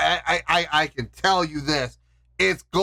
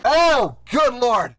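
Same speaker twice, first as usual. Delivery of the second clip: high, loud exclamation; overwhelmed relief; 7 semitones higher, 4 dB louder than usual. Real, not acted. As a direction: cry out in sheer relief. Overcome with relief.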